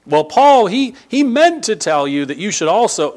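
preacher parodying those who would second-guess Paul; mocking critic voice. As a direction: lift the voice to mock a critic's objection. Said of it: "other people come along behind me and say".

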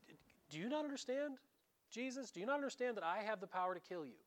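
a hint of a threatening governor; incredulous Pilate. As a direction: press him, stunned he doesn't get it. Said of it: "you can hear it in the next words".

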